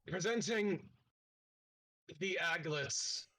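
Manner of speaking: grandly announcing, a touch slow; guard forced to present the party, proud announcing; forced proud announcement. Acted. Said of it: "forced to say it in a proud voice".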